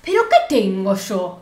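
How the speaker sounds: drawn-out exasperated complaint; slow, put-on indignation; retold annoyance. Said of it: "Flor no la ves enojada nunca". (fastidio imitado, alargando las palabras)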